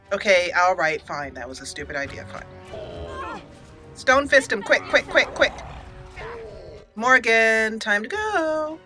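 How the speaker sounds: ordinary delivery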